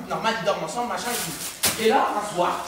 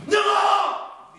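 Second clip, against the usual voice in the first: shout; 9 semitones higher than usual. Retold or imitated. Bursts in calling out.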